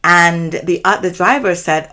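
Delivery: ordinary talk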